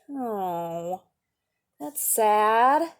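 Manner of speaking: usual speaking voice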